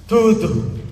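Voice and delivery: curt, sulky one-word answer. Acted. Sulking partner answering a worried sweetheart, sulky. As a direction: act like you're clearly upset but won't admit it. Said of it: "que você tá emburrada".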